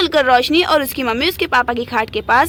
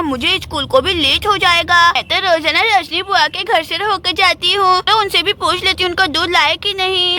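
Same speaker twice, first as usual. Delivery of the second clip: put-on childish lisping chatter; little girl Gudiya. Girl hurrying to fetch milk before school, childish lisp. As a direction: talk like a small lisping girl.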